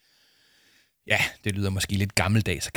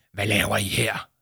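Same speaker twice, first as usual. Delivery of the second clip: harsh. Acted. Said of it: "spurgte han hårdt".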